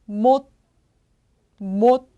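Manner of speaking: 못 alone, stopped on a final t; pronunciation demo. Shown as a demonstration. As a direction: model 못 with the tongue stopping the t. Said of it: "이렇게 읽어주시면 됩니다".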